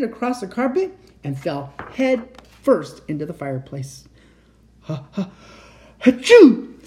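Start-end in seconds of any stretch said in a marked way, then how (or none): 4.8-6.8 small build-up, explosive high sneeze; hammed-up, very loud achoo; acted sneeze. A narrator's reading. Santa with soot in his nose, sneezing. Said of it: "sneezed Santa".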